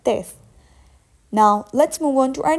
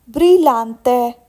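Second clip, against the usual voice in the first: normal talking voice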